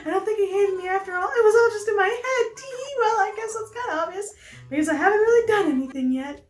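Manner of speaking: very high, rapid, giddy character voice; Pelly. Acted. Pelly rejoices over Pete's greeting, high and giddy. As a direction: squeal with lovestruck relief.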